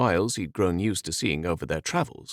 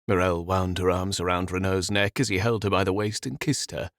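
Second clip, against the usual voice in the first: usual speaking voice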